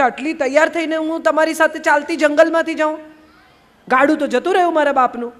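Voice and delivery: a sulky bride's complaint; fussy whining, a bit hurried; whiny complaint. Acted. Lali complains to Kabir.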